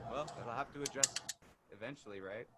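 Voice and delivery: faint, far-off audio; barely audible. Real, not acted. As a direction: sound distant and faint. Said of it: "You still kinda sound far away".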